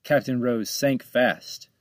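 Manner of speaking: ordinary delivery, nothing marked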